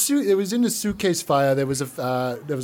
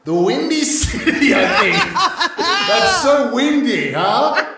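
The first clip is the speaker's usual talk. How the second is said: slow, high, loud joke, laughing through it; loud laughing slow gag; laughing riff; 6 semitones higher, 4 dB louder, slower than usual. Acted. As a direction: drag it out, cracking yourself up. Comedian riffing with laughter.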